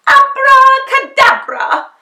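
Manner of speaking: loud, high, slow magic shout; shouted spell. A narrator's reading. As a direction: call out the spell grandly. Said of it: "and shouted".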